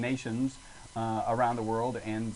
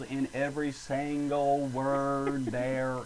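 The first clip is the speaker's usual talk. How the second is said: put-on southern accent; much slower than usual. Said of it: "One of the things with a southern accent".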